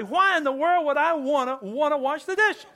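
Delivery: God-fearing man. Retold or imitated. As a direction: play the baffled man, lightly hammed. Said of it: "what any God-fearing man would say".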